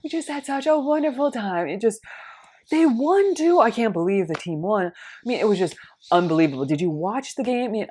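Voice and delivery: high, bubbly gush about the game; gushing winner. Retold or imitated. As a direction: rave about the wonderful day. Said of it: "And she's like".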